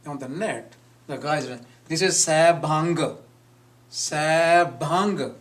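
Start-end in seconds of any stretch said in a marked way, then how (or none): 1.9-5.3 "Sabhang" ending in a released "guh"; "Sabhang" as "sa-bhang-guh", the nasal cut short by a G; hard G ending. Shown as a demonstration. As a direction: say "Sabhang" wrongly, with an audible G at the end. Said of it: "There is no G there".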